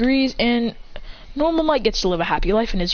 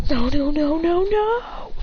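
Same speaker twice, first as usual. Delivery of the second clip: mock panic; 6 semitones higher, slower than usual. William voiced, surrounded by enemies.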